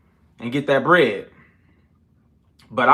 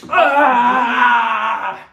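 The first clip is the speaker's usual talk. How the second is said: loud strained cry of effort; exertion shout; 7 semitones higher, 4 dB louder than usual. Real, not acted. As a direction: let out a strained yell after the push-ups. After push-ups, exertion shout.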